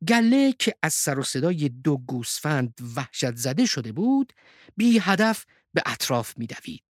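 ordinary delivery, nothing marked

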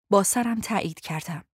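usual speaking voice

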